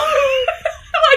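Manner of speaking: a high squeal of laughter at the irony; high burst of laughter. Real, not acted. Telling a friend the twist, squealing with laughter.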